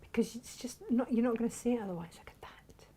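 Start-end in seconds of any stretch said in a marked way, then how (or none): 2.2-2.8 hushed, voiceless whisper; low-volume reverent whisper. Real, not acted. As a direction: whisper it as if sharing a secret. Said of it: "I don't know why we're whispering".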